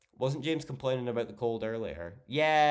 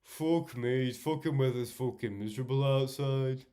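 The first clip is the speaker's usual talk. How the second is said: a light put-on Scottish accent, grumbling about the rain; mock Scottish moan about the weather; Scottish impression; 3 semitones higher than usual. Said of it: "you're like".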